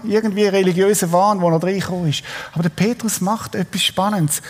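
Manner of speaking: ordinary talk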